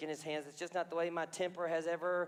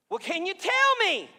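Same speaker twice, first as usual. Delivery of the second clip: much higher, loud anxious question; king calling out in dread; 11 semitones higher, 10 dB louder than usual. Acted. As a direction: push Daniel, rattled. King pressing Daniel.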